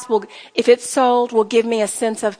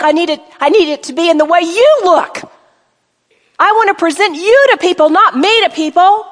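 raised, louder pleading prayer to the Lord; fervent modelled prayer; 4 semitones higher, 5 dB louder than usual. Shown as a demonstration. Preacher models a prayer for the ladies, fervent and loud. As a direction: pray it out with rising fervour. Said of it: "You can ask for".

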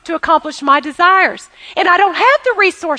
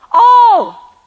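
"All" shouted high and loud; shouted answer; 4 semitones higher, 6 dB louder than usual. Real, not acted. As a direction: call out the answer. You would have it what?.

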